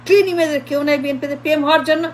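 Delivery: ordinary talk, nothing marked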